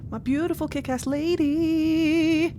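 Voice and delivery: dreamy, delighted admiration; fond gush. Real, not acted. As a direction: gush over her lovingly. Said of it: "whilst I gush over my friends proudly".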